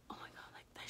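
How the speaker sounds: hushed wince at being hit; pained whisper. Retold or imitated. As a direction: whisper the complaint, half hurt. Retold getting hit accidentally.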